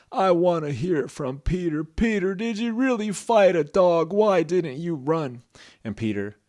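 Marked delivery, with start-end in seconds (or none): 0.1-5.4 loud, high, insistent grandfather; booming incredulous questioning; stern questioning Grandpa. Acted. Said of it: "the grandpa said".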